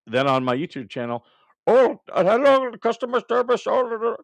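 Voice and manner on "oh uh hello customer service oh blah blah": lightly voiced mock Indian accent, high. Streamer mocking rival's fake Indian accent, lightly imitated. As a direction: mimic a call-centre voice in a light Indian accent. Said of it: "fake Indian accent".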